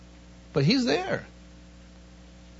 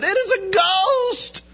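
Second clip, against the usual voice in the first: terrified cry; 11 semitones higher, much slower than usual. Acted. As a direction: scream that you have seen a ghost. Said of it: "they were terrified and said".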